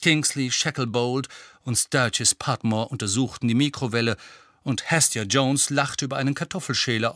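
ordinary delivery, nothing marked

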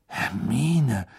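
hushed awe on a drawn-out name; verdutzt und beeindruckt. A narrator's reading. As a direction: say it stunned, half admiring. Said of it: "verdutzt und beeindruckt zugleich". (verblüfft und beeindruckt, gedehnt)

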